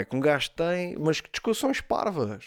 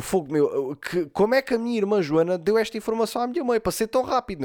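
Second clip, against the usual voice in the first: higher-pitched, incredulous questioning; baffled; 7 semitones higher than usual. Retold or imitated. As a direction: retell it in baffled disbelief. Retells his baffled reaction. (incrédulo, indignado)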